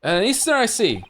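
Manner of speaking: high mock Scottish governor; amateur Scottish accent; attempted Scottish accent. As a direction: pitch it up in a rough Scottish accent. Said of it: "I can't do a Scottish accent".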